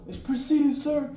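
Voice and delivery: high, loud answer to the MTI; yelled reply. Retold or imitated. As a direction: shout it back as you run. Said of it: "what you're yelling".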